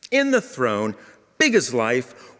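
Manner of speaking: usual speaking voice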